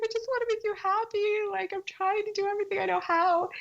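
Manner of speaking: reliving the tearful prayer; a touch of crying in the voice; retold crying. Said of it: "like, crying".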